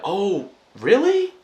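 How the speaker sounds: high, slow drawn-out mock surprise; imitating surprised boss. Boss hearing about the nuts, imitated in slow high surprise. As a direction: play the boss caught off guard, stretched out.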